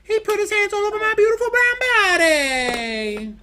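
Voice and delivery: very high, loud falsetto wail; hammed-up high-pitched lament; wailing comic character. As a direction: shriek it in falsetto, stretching the last word. Acts out the Martina character bit.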